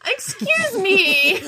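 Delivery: high, very drawn-out offended outburst; indignant. Real, not acted. Teased woman objecting to Australia claim, indignant. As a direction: stretch it out in mock outrage.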